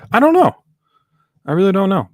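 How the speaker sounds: high, loud burst of exasperation; exasperated. Real, not acted. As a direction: throw your hands up, loud and high.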